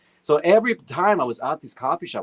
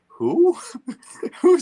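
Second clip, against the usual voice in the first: mock surprise; 4 dB louder than usual. Retelling his puzzled reaction.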